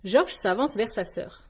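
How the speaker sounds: plain reading voice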